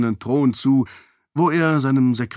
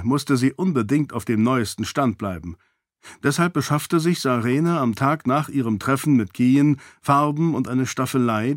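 ordinary delivery, nothing marked

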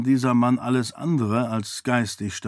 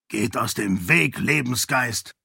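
restrained menace in a changed voice; warning. A narrator's reading. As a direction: warn him off, sword drawn. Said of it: "warnte Dache mit gezücktem Schwert".

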